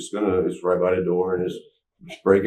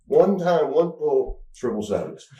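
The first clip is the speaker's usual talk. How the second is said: high, raised voice in amazed disbelief; excited, pitched-up retelling of the jackpot; 8 semitones higher than usual. Real, not acted. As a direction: marvel at the one lucky pull.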